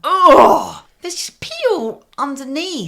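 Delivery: high, whiny near-cry of disgust; disgusted whine. Retold or imitated. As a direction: wail it like finding pee everywhere. Finding pee under the seat.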